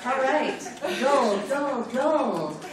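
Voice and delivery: normal talking voice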